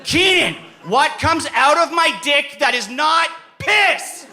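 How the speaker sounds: high, loud, drawn-out yelling; retold furious yell. Pissed-off player to his partner in a public place, yelling. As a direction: bellow it slowly at your hopeless partner. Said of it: "but I'm yelling at you".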